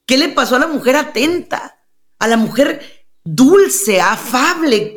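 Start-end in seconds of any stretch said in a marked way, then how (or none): none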